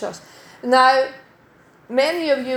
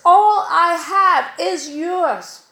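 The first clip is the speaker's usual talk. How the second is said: slow, higher and louder, lingering on "All"; tender promise; 6 semitones higher, 4 dB louder, much slower than usual. Retold or imitated. Preacher voicing God's promise to his children, slow and tender. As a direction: hand them the promise slowly and warmly.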